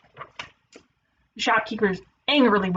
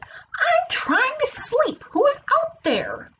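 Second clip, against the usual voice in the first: high, slow, exasperated complaint; irritated sleeper; much slower than usual. Acted. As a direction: stretch out the annoyed call. Wolf irritated by noise while sleeping.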